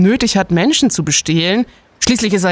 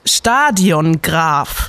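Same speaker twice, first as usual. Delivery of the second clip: spelled out slowly; 3 semitones lower, much slower than usual. Acted. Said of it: "Er heißt immer noch Stadiongraf".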